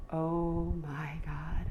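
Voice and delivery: slow breathless whisper; hushed stunned whisper. Retold or imitated. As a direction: breathe it out in shaken relief. Relief after dog is spared.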